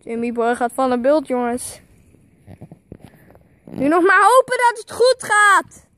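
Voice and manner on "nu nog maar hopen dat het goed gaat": excited. Real, not acted. Filming a risky stunt.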